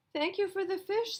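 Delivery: very high squeaky seal voice. Acted. Seals thanking Mister Johnson, high squeaky voice.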